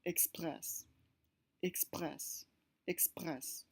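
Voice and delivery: express with an open eh vowel, said slowly three times; French è sound. Shown as a demonstration. Teacher repeating an example word, French è. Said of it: "pronounced like E".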